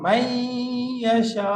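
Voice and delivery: মাইয়াশা slow with humming nasal on the ya; nasal ghunna. Shown as a demonstration. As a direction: hold the ghunna through মাইয়াশা. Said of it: "গুন্না হবে". (গুন্নাসহ ধীরে মাইয়াশা)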